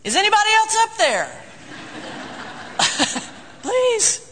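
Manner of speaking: a desperate comic whine for another option; man on the cliff begs for someone else; comic plea. Retold or imitated. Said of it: "and he said".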